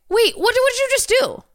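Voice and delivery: high, squeaky disbelief; stunned by the answer; shocked. Real, not acted. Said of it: "outraged at the robot".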